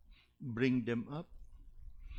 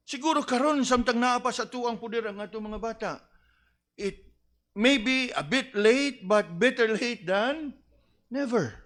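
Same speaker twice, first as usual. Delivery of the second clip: higher and louder, words slowed and pressed; fervent preaching; 8 semitones higher, 5 dB louder than usual. Acted. Preacher urging parents to act before it is too late, fervent. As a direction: urge the parents not to wait.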